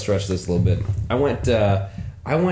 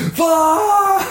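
loud, much higher mock outburst; frustrated yell; 5 dB louder than usual. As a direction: blow up at the memory of the ticket. Reliving frustration at ticket.

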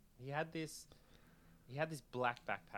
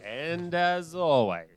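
loud, slow sign-off; announcer voice; 6 semitones higher, 16 dB louder, much slower than usual. Acted. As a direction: boom it out like a host. Show sign-off flourish.

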